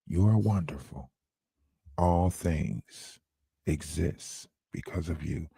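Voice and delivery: deep, soft compliments; low mellow sweet talk. Pretend. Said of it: "I want a low and mellow voice".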